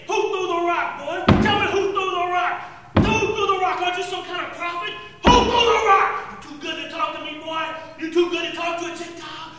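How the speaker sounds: high, loud, yelling interrogation; furious shouting soldier. Retold or imitated. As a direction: bellow at the prisoner while beating him. Soldier beats Jesus, demanding.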